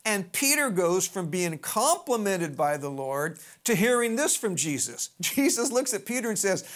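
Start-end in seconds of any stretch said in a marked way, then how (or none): none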